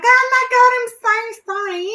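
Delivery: loud, high-pitched bogan Aussie apology; hammed-up broad Australian bogan outburst. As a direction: squawk it like the biggest bogan Aussie. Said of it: "Like the biggest bogan Aussie".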